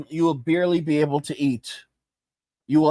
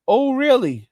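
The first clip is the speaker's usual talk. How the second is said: higher and louder, mocking 'Oh, really?'; sarcastic; 8 semitones higher, 4 dB louder than usual. Answering the boomer's claim with sarcastic disbelief. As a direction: throw it back with scorn. Sarcastic reply in mock exchange.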